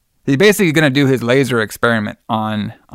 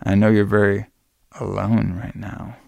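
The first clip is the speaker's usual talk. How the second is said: soft, halting imitation of a creep; creepy; 2 semitones lower, 4 dB quieter than usual. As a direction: play the creep, softly with unsettling pauses. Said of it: "Ooh, what a creep".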